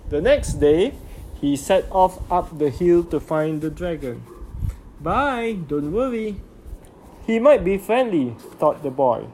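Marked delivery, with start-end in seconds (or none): 5.0-8.4 lightly voiced little boy; a hint of a cheerful child; young boy voice. A narrator's reading. Boy's voice in story.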